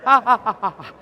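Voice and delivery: loud, hammed-up ouch cries; mock pained cries. Nursing mother bitten by baby, mock loud cries.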